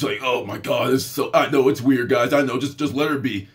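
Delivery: low, apologetic aside, acted; embarrassed parent.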